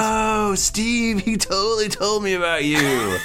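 high, excited bro voice; stoner voice. Acted. Uthgar voiced on the phone.

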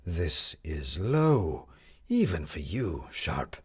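slow, bitter accusation; lightly voiced Jefferson. A narrator's reading. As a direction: let it drip with contempt.

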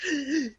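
sharp, high intake of air; anxious gasp. Retold or imitated. Daughter weighed down by anxiety upstairs, gasping. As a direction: gasp as if trying to catch breath. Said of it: "trying to catch my breath".

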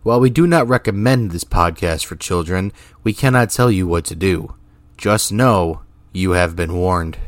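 loud, emphatic podcast warning read; announcer disclaimer. Acted. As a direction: deliver it as a loud formal content warning. Reads the podcast's opening disclaimer.

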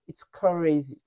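ordinary talk

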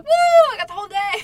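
high, loud whoop of joy; happy cheer. Retold or imitated. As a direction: whoop it out like you've just been set free. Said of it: "I was so happy to see the sun".

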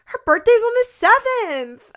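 loud, high exasperated outburst. Retold or imitated. Chelsea correcting Adam on the birthday, exasperated. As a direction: imitate her at the end of her patience, shouting.

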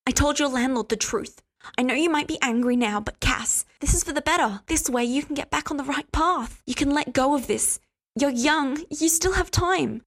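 soothing him like a patient mother. A narrator's reading. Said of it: "she said, soothingly stroking".

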